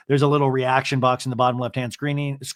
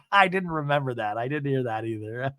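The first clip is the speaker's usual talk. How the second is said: imitated cackling; 2 semitones lower, 4 dB quieter than usual. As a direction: talk between put-on cackles. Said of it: "Tamara's cackling, going".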